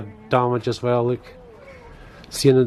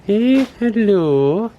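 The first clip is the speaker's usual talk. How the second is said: high, drawn-out coo at a bird; cooing at an owl; 9 semitones higher, 4 dB louder, much slower than usual. Real, not acted. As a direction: greet the owl like a pet, slow and sweet. Greeting an owl at the zoo.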